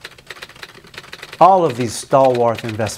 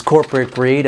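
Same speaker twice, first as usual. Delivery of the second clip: ordinary talk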